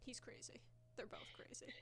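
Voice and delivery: hushed whisper. Real, not acted. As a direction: whisper it under your breath. Whispered aside about the cat.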